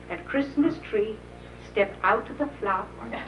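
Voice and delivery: plain reading voice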